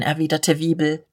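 usual speaking voice

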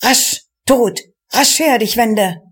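narrated cry of a fleeing man, held back; understated cry. A narrator's reading. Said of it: "Der Vordere schrie".

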